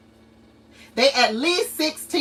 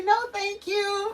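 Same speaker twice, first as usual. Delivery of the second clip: put-on high sing-song little-girl voice; a child brushing off mommy, sweet and high; toddler's sweet no thank you; 10 semitones higher, 4 dB quieter than usual. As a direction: chirp it like a polite toddler. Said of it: "And she would say".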